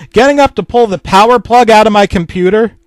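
plain reading voice

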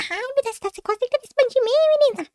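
high-pitched squeezed complaint; reclamação indignada. Acted. Menina espremida reclama da outra. (reclamando indignada, voz aguda)

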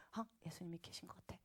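very soft, low near-whisper; awed hush. Retold or imitated. Woman sensing Jesus in the room, hushed. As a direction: whisper it as a sudden realization. Sudden sense of Jesus being present.

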